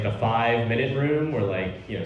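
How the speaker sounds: usual speaking voice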